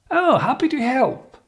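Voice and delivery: "happy to help" very high, with a big friendly swoop; high pitch intonation. Shown as a demonstration. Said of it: "the higher, the better".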